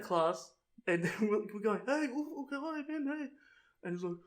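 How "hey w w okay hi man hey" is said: high, soft-voiced call-out; mock friendly calling. Friends greeting him in class, mock cheery calling. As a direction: lightly imitate hailing a friend through a classroom.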